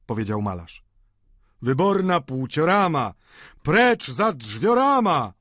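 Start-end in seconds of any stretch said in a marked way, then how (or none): 1.6-5.3 slow, drawn-out mocking cry; higher, stretched-out taunt; jeering. A narrator's reading. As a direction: chant it like a jeering crowd. Diners jeering with mock words.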